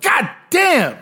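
high, startled god damn; shocked outburst. Retold or imitated. Tourist reacts to a sixty dollar price, high and startled. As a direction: yelp at the price. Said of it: "And I was like".

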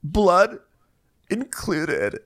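high, halting mock-hurt voice; put-on wounded dignity, high-pitched; acted-out housewife. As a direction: declare it in high, broken phrases. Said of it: "And Kathy goes".

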